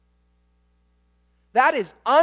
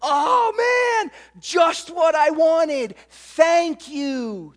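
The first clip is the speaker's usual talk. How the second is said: put-on shouted, much higher, delighted kid ripping open a gift; excited proclaiming; 6 semitones higher, 4 dB louder, much slower than usual. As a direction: burst out with a kid's glee. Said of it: "he proclaims with excitement".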